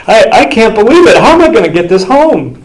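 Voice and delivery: high, loud, giddy disbelief; overwhelmed owner. Retold or imitated. Owner who can't believe his luck, high and giddy. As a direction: gasp it out, still reeling.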